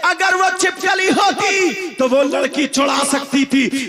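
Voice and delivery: loud, driving sermon over music; fiery preaching. Acted. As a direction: thunder the warning at the congregation. Preaching at full force.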